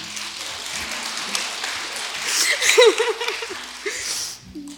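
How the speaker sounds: loud, high burst of laughter; nervous laugh. Real, not acted. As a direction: laugh out the tension. Nervous after sharing testimony.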